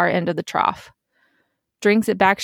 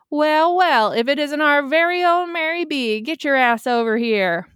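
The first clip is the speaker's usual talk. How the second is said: Southern twang; 7 semitones higher than usual. A narrator's reading. Bartender greeting a regular over the noise, Southern twang. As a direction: pile on the Southern twang as you call over the bar. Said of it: "A voice dripping with Southern twang".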